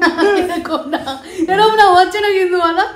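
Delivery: laughing while protesting; giggling through the words. Real, not acted. Laughing at fake crying.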